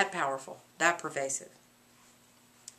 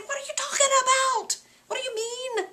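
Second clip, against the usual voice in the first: much higher, exasperated; impatient Lady Macbeth; 14 semitones higher than usual. Acted. Lady Macbeth cutting off her husband, much higher. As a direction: snap at his rambling.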